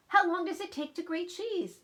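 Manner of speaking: put-on high, exasperated child; impatient. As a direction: complain about the endless wait.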